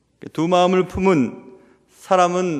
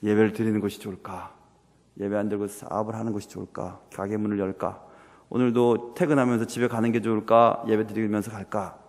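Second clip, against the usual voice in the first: low, subdued inner deliberation; weighing it up; 8 semitones lower, 5 dB quieter than usual. Acted. Wavering churchgoer choosing between worship and business, musing low. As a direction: mull each option over quietly. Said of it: "재고 있는 것입니다".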